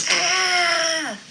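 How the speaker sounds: high, loud anguished scream; uncle's scream. Retold or imitated. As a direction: let out a shout of agony. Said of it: "I heard the uncle go".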